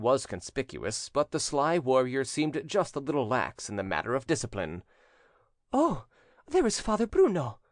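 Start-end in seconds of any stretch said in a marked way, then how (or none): 5.7-7.6 high, excited damsel's voice; sudden exclamation. A narrator's reading. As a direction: blurt it out in a high voice. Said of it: "said Gelsomina suddenly".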